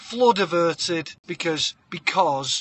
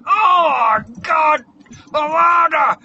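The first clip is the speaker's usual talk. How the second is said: high, loud wail with no bottom lip; hammed-up howl of pain; anguished mother's cry; 9 semitones higher, 10 dB louder, much slower than usual. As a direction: shout it lipless and in agony. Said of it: "all of her teeth there going".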